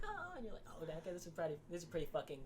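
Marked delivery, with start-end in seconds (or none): 0.0-0.3 mock groan. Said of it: "he was like".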